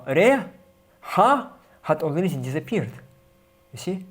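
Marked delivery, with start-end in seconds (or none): none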